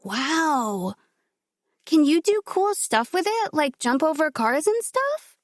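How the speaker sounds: put-on high, slow child's question; wide-eyed kid asking innocently; curious little girl. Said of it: "Morgan looked at".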